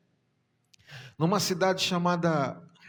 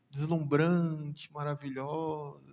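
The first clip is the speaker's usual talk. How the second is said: ordinary talk, nothing marked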